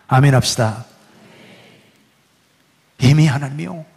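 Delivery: ordinary talk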